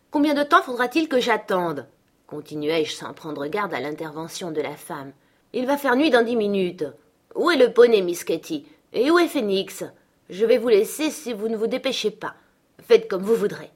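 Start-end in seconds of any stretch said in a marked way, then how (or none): 0.1-1.9 exiger, plus haut et plus fort; Hélène presse Catherine de partir; impatiente. Acted. 5.5-13.7 exiger, plus haut et plus fort; Hélène presse Catherine de partir; impatiente. Acted.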